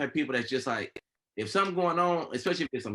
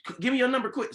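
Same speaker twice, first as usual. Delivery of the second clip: higher, urgent mom asking fast; 7 semitones higher than usual. Retold or imitated. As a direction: press for the number quickly. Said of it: "my mom like".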